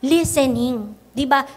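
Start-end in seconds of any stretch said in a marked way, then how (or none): none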